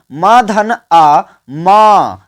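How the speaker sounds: म धन अ drawn out, each sound apart, then म said whole; very slow syllable demo. Shown as a demonstration. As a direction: lay out म धन अ slowly for the class. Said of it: "देखिए उच्चारण पर ध्यान दीजिए".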